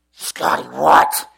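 low voice, loud burst on "what"; imitated groggy mom. Woken mom to her son, mock gruff bewilderment. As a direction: play a mother woken and baffled. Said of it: "And my mom's like".

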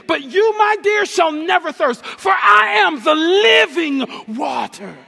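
starts level, climbs to a loud, high, ringing shout; Jesus imagined as a preacher, building to a shout; building preaching shout. Acted. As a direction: preach it up until you're shouting the promise. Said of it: "what Jesus was thinking but never actually said was".

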